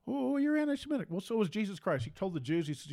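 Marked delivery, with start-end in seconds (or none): 0.1-1.1 much higher, shocked mock voice; mock outrage. Speaker mimics an imagined accuser in a much higher, mock-shocked voice. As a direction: squeal it like a horrified critic.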